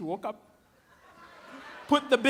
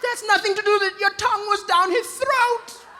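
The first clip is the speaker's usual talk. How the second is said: louder, higher retold outburst; indignant protest; 7 semitones higher, 5 dB louder than usual. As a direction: protest loudly, dragging out the accusation. Retold protest in quarrel with partner.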